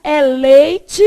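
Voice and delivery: loud, insistent retold correction; emphatic correction. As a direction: hit "leite" hard, keeping the I. Correcting son's pronunciation.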